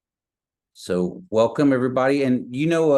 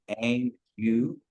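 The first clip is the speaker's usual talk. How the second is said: ordinary talk, nothing marked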